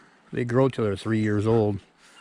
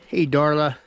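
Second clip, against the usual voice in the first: ordinary talk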